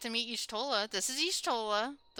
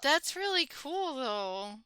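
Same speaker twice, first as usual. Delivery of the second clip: amazed; 6 semitones higher, much slower than usual. Real, not acted. Amazed at discovery.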